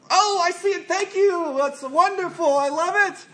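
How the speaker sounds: high, bright overdone praise; gushing parent. Retold or imitated. Parent gushing to child over drawing, high excited praise. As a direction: gush over a scribble.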